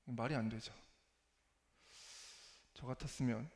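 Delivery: ordinary delivery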